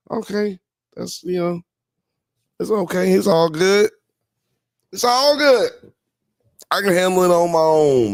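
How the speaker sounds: high, hurt, pretending it's fine; mock-wounded high voice. Real, not acted. Streamer to chat who abandoned him, mock-hurt high voice. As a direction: act brave but sulky.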